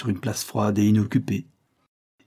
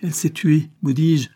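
higher, louder frantic insistence; urgent, pitched-up insistence; insistent; 6 semitones higher, 4 dB louder than usual. A narrator's reading. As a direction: insist on it, voice raised. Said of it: "insista la servante".

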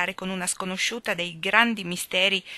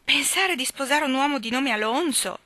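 pained exclamation; 7 semitones higher, 3 dB louder than usual. A narrator's reading. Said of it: "esclamò addolorata Phil".

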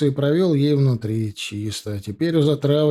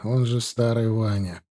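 plain reading voice

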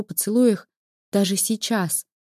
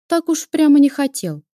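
skeptical; 6 semitones higher, 5 dB louder than usual. A narrator's reading. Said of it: "вскинула брови сестра".

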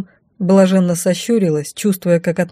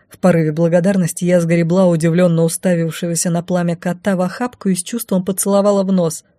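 ordinary delivery, nothing marked

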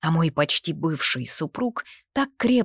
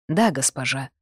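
a hint of a lower knight voice; lightly voiced knight; 3 semitones lower than usual. A narrator's reading. Knight answers his lady, lightly voiced and a touch lower. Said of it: "поклонился он".

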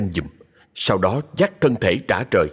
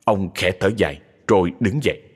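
ordinary delivery

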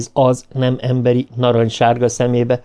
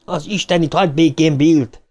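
raised-pitch shout, moderate volume; blaring demand; 6 semitones higher than usual. A narrator's reading. Ben defends Bill from the spider, bellowing. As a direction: call out the curse and order.

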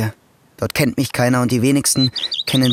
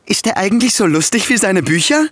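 mock curious colleague; 7 semitones higher, 3 dB louder than usual. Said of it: "nephew imitates curious colleagues".

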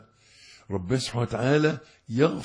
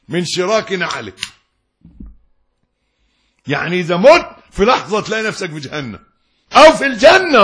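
fiery preaching; 9 semitones higher, 14 dB louder than usual. Acted. Preacher warns the listener about doubting judgement day, fiery and loud. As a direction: hammer the point home to the congregation.

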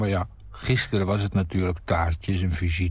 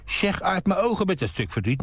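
higher mock angry father; mock stern father; 10 semitones higher than usual. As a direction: ham up the strict dad sending the boy off. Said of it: "Je zegt niet". (spottende strenge vader)

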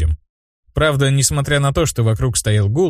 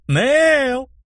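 high name held long; drawn-out cry. A narrator's reading. Vilma taunting her friend, long high call. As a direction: stretch the name out, high and teasing. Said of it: "Ещё больше растягивая моё имя".

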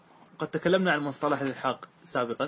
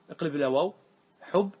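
ordinary talk

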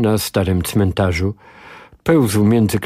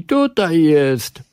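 high, slow, sing-song call; children shouting to the pursuers; children yelling; 7 semitones higher, much slower than usual. A narrator's reading. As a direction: call it out like a kid at play. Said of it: "wrzeszczały dzieciaki".

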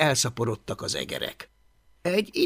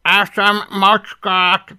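louder, higher dragon voice, slowly; defiant little dragon; 6 semitones higher, 7 dB louder, slower than usual. Acted. Dragon threatens to eat the cat, defiantly.

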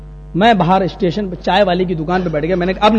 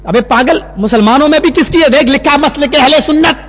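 higher-pitched angry lecturing; imitated scolding; 6 semitones higher than usual. Mosque man pointing out the sect, angry scolding. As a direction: keep the voice raised and insistent.

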